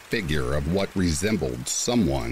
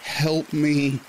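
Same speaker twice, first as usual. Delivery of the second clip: put-on girl's scream; 11 semitones higher than usual. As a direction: scream it like a terrified girl. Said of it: "who then yelled".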